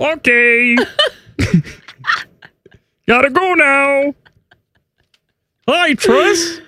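high puppet voice breaking into laughs; strained, put-on high voice; Kermit. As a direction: sign off like a giggly frog puppet.

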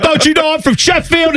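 high, squeaky mock English bragging; English accent. Hooligan boasting of his town in a mock English accent. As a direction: puff up about Sheffield in a silly English voice. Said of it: "It's not a bad English accent".

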